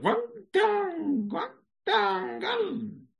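very high squeaky nonsense Chinese, strained; mock Chinese gibberish. Dying patient babbling fake Chinese at the visitor, squeaky high gibberish. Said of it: "He goes".

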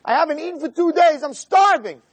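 pleading hungry man. Retold or imitated. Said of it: "someone coming over to me and saying".

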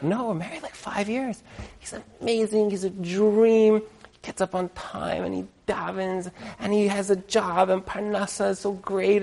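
high, soft, dreamy praise; gushing new wife. Retold or imitated. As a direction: swoon over the husband. New wife gushes about husband.

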